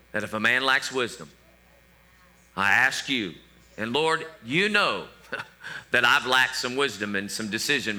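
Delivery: soft-spoken prayer to the Father. Shown as a demonstration. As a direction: pray it humbly and sincerely. Demonstrates a prayer.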